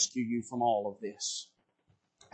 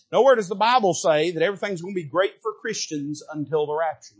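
ordinary talk

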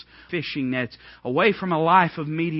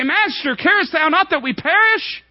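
high, loud desperate plea; panicked cry; 14 semitones higher, 8 dB louder than usual. Acted. Disciples crying to sleeping Jesus, high, loud and panicked. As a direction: cry out in terror of drowning. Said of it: "they cried".

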